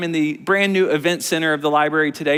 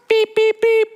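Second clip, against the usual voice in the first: high, louder beep beep; high beeping alarm; 5 dB louder than usual. Acted. Teacher sounds the rescue alarm for the kids, high beeps.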